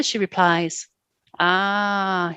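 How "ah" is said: leering. A narrator's reading. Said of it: "he leers".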